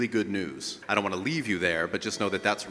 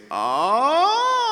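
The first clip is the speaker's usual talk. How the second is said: much higher, louder crying ah; exaggerated cry; 8 dB louder than usual. Shown as a demonstration. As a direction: wail it up like a creaky door. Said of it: "creaky cry exaggeration".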